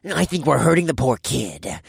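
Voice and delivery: mocking.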